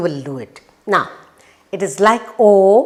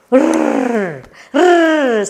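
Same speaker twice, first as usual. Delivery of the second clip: high, long rolled "rrr"; puppy-like trilled "rrr"; rolled R sound; 5 semitones higher than usual. Shown as a demonstration. Teacher showing how puppy pulls the rag, long rolled R. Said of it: "the sound of letter R".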